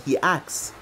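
usual speaking voice

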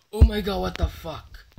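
jolted by a fright mid-sentence; startled. Real, not acted. Player spots someone watching in a horror game, startled.

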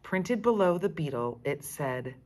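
plain reading voice

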